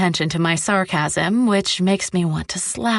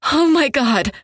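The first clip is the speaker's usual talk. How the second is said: high, louder gasp of fear; choked terror; 7 semitones higher, 4 dB louder than usual. A narrator's reading. Captive to her captor in the plush cell, choked terror. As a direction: choke it out, fighting back panic. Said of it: "I choke out, fighting back terror".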